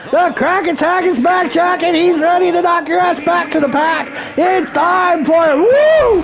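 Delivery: high hyped-up shout; rowdy party animal. Pretend. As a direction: yell it like a ring entrance. Wrestler entrance hype call.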